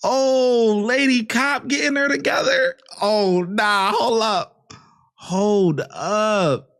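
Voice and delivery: loud, thrilled onlooker; excited. Real, not acted. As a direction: call it out, hyped up.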